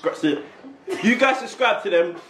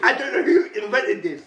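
upset crying; 8 semitones higher than usual. Real, not acted. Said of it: "He's crying".

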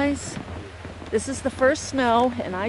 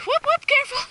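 excited; 3 dB louder, faster than usual. Real, not acted. Calling to sledder going down.

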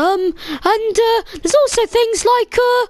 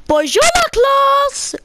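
mock French accent; 6 semitones higher than usual. Player voices French teacher greeting.

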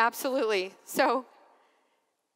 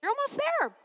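much higher, bright calling-out encouragement; encouraging girl; 12 semitones higher, 4 dB louder than usual. Retold or imitated. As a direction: call down to the climbing priest to cheer him on. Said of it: "She goes".

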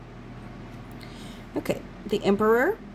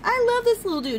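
squeaky gushing; 14 semitones higher than usual. Real, not acted. Gushing over card.

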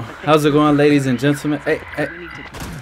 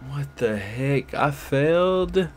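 slow, soft defeated groan; deflated; 5 dB quieter, much slower than usual. Real, not acted. Gamer who just failed, slow and deflated. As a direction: drag it out, all the air let out. After dying, dejected.

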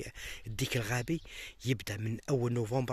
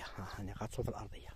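"اني غطسته في الارضيه" said again in a soft whisper; hushed whisper so the birds stay calm; whispered repeat; 3 semitones lower, 7 dB quieter than usual. Real, not acted. Repeats the phrase, the second time whispered.

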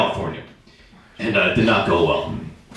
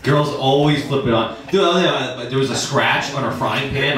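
ordinary talk, nothing marked

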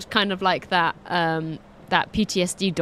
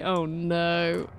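usual speaking voice

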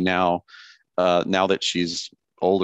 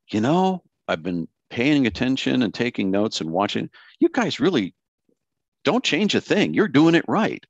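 higher-pitched mock verdict to the newsroom; imagined consultant; 4 semitones higher than usual. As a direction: pretend consultant praise, pitched high. Imagined consultant praising station.

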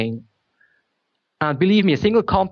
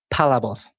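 PA-la-bos, emphasis on the first part of "Palabos"; "Palabos" with the weight up front; stress on first syllable; 3 semitones lower than usual. Shown as a demonstration. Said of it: "with the emphasis on".

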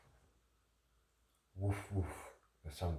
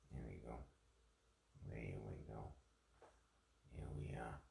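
soft murmur; 5 semitones lower, 11 dB quieter than usual. Real, not acted. Settling back after adjustment.

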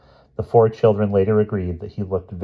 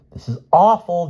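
high, louder alarmed cry; cried out; 10 semitones higher, 5 dB louder than usual. Acted. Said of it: "Jane cried".

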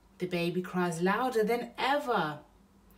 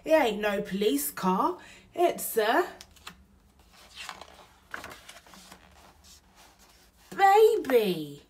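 louder and higher, stunned discovery; amazed; 6 semitones higher, 8 dB louder than usual. Acted. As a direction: build to the big reveal. Bill discovers the baby.

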